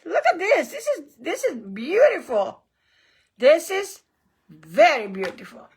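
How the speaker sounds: normal talking voice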